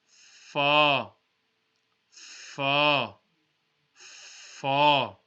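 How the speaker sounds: Fa with top teeth on the bottom lip; Arabic lip letter Fa. Shown as a demonstration. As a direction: bite the lip lightly for Fa. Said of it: "top teeth will be touching the bottom".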